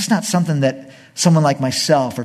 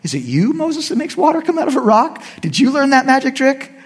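sarcastic taunt; 11 semitones higher than usual. Retold or imitated. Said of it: "Moses mocks the people".

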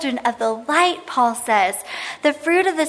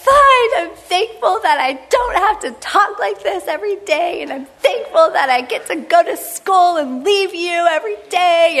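put-on high, whiny sarcastic teen voice; sulky teen; 4 semitones higher than usual. Teen sarcastically listing thanks to mom, whiny. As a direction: sulk it out like a bratty teenager. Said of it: "I was like".

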